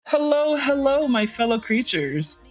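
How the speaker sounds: normal talking voice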